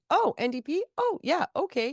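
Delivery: high, breezy shrug of a casual voter; imitating a casual voter shrugging it off. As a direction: play the voter who just goes along with it. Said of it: "they maybe went".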